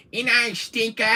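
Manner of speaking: high, loud cartoon rabbit voice; high and loud, doing the rabbit for the viewers; Bugs Bunny voice. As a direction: toss it off like a cheeky cartoon rabbit. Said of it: "a few good lines of Bugs Bunny".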